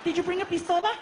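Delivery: high, dramatic soap-opera lady; a high, melodramatic novela voice; telenovela voice. Retold or imitated. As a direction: ham it up like a telenovela heroine. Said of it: "sound like you're in one of those novelas".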